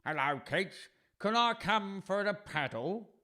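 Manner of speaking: put-on quacky duck voice; duck character. Duck asking children for a paddle, quacky voice. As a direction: ask it like a cartoon duck.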